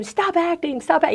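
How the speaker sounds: mock scream. Workshop teacher screaming at an acting student, mock scream.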